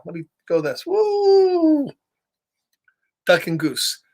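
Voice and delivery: ordinary delivery, nothing marked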